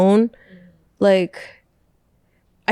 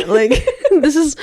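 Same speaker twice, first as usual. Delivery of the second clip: laughing; 7 semitones higher than usual. Real, not acted. Laughing at mirror story.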